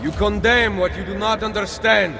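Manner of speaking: fierce shouted defiance; defiant shout. Acted. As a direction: throw it back at him, loud and high. Defiant retort to accusing priest.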